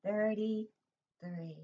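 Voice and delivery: very slow, stretched "Thirty-three"; slow teacher modelling of "Thirty-three"; drawn-out number. Shown as a demonstration. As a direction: stretch it out for little ones. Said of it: "Start with the three".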